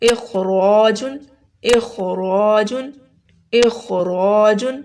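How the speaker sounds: اخراجن slowly, thick throaty kh and rounded "raw"; heavy kho and ra. Shown as a demonstration. Teacher models an example word, slow heavy اخراجن. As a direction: draw out اخراجن full-mouthed on both heavy letters. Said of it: "خو اور رو دونوں موٹے پڑھے جائیں گے".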